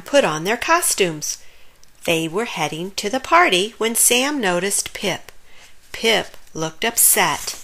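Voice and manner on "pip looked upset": plain reading voice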